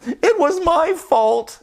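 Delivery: high, slow bird voice; sorry in a high birdy voice; tall bird. Acted. Tall bird apologizing in a high voice. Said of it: "said the very tall bird".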